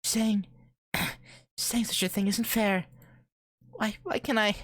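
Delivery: put-on high-pitched upset girl's voice; tearful girl. A narrator's reading. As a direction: plead high, without real sobbing. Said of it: "Her tears course down her face".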